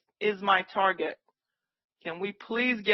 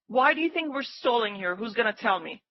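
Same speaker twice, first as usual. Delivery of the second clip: usual speaking voice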